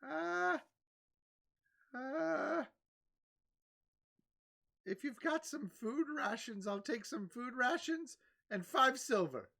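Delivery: put-on old merchant lady. Old merchant lady haggling with the party, high and hesitant. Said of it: "and she's like".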